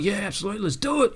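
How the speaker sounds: high-pitched, thrilled yes; acted excited bandmates; eager agreement. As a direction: jump at the offer in a high voice. Band eagerly accepts record deal.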